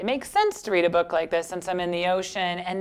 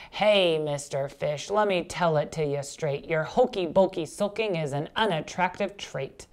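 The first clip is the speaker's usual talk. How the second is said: blunt octopus. Acted.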